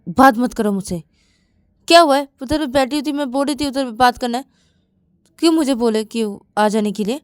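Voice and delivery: loud, high, indignant complaint; angry rebuff. Acted. Shreya snaps at Shruti, angry and loud. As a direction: lash out and shut her down. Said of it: "गुस्से में क्यों".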